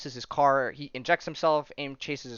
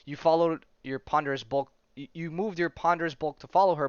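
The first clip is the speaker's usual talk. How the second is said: ordinary talk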